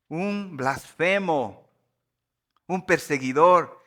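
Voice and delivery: emphatic scripture declamation. Acted. Preacher quoting Paul's thanks to the congregation, slow emphatic declamation. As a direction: proclaim each phrase, drawing out the key words.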